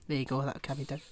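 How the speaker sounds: ordinary talk, nothing marked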